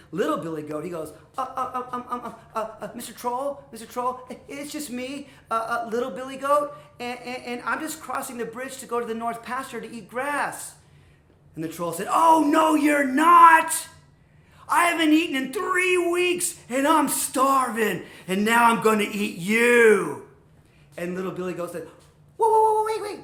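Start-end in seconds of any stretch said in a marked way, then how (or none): 1.3-10.7 higher-pitched stammering voice; timid, stuttering explanation; nervous little goat. Acted. Little goat answering the troll, stammering nervously. 12.1-20.2 very loud, drawn-out bellow; menacing troll. Acted. Starving troll threatening little goat, roaring.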